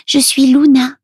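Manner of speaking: put-on high little-girl character voice; Luna. A narrator's reading. Little girl gives her name to Milo, high child voice. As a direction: answer simply as a small girl, high-pitched. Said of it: "répond-elle".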